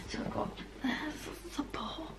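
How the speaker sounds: soft voiceless whisper, voice gone; whispering from lost voice. Real, not acted. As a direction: whisper it, you can barely speak. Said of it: "Apologies for the voice".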